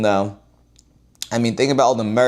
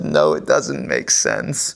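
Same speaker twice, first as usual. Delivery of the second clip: low, slow, stuffy mock voice; mock Disney executive; 11 semitones lower, slower than usual. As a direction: parody a pompous boss saying no. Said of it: "and you said".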